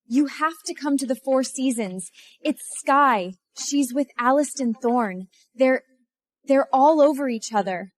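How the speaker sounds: urgent tattling Serafina. Acted. Mistress phones Liam to report Skye, high and urgent. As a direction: raise the pitch and pass on the scandal.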